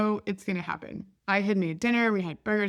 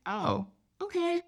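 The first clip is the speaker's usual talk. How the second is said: dawning realization; 10 semitones higher, 4 dB quieter than usual. Retold or imitated. Mom realizes what baby wanted.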